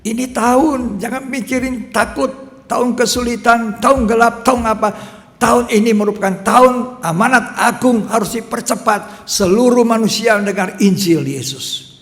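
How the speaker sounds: loud, high, driving sermon voice; rally the congregation with fire; fiery preaching. Acted. Preacher urging the church to spread the gospel, loud.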